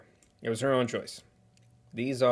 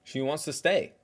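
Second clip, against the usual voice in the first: sarcastic; 5 semitones higher, 4 dB louder than usual. Real, not acted. Mocking the host's farewell line.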